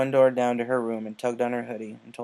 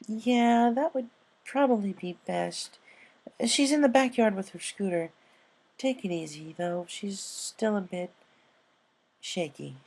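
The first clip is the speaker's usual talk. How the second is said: higher mare voice, caring and hushed at the end; gentle caretaker pony; 9 semitones higher than usual. Acted. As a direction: speak carefully about the shaken filly. Purple pegasus voiced in story.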